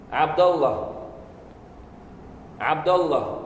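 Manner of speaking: Abdallah with a clear a after the d; Abdallah, dal with fatha. Shown as a demonstration. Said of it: "vous allez entendre certaines personnes dire". (Abdallah avec un a après le d)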